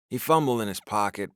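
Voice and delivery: ordinary delivery, nothing marked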